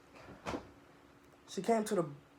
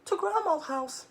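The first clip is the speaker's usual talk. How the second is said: nervous; 12 semitones higher than usual. Acted.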